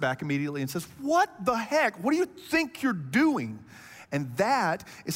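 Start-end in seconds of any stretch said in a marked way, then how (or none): 1.0-3.6 higher-pitched mock outrage; indignant reaction. Friend recoils at what you did, indignant. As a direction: act out an offended friend's disbelief.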